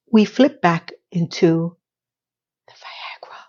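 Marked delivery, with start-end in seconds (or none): 2.7-3.4 hushed, naughty reveal; conspiratorial whisper. Pretend. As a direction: whisper it like a secret. Mock-secret whisper, then said aloud.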